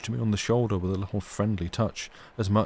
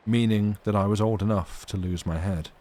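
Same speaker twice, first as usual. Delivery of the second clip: plain reading voice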